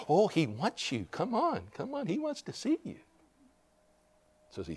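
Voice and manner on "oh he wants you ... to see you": very soft, high, eager urging; happy urging. Retold or imitated. Crowd urging a blind man forward, hushed eagerness. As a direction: coax excitedly in a hushed voice. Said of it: "all the people got happy".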